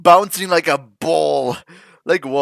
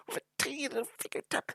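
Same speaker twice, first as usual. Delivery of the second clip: much softer, fast, small voice for "tiny little finger tap"; 9 semitones higher, 16 dB quieter, much faster than usual. Real, not acted. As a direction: shrink the voice to match the tiny finger.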